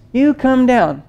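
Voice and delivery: usual speaking voice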